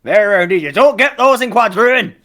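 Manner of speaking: very high, loud holler; strained high-pitched sailor's shout; deckhand shouting back. Acted. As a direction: yell out the cargo list from afar. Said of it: "And Bob goes".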